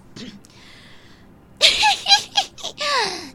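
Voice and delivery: high, loud witch cackle; cackling hag laugh. Acted. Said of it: "cackling, laughing".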